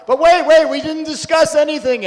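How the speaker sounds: high, loud imitation of frantic pleading; shouted protest. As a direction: yell after a departing van, acted out. Family shouting at departing van.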